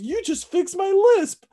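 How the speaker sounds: high, loud mock exclamation; imitating punched movie villain. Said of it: "he gets punched in the face, and he goes".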